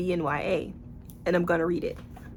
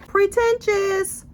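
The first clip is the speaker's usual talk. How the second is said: much higher, louder and slow, drawn out in scorn; scornful verdict; 12 semitones higher, 5 dB louder, much slower than usual. Real, not acted. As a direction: stretch it out like a sneering judge. Judging the author's writing.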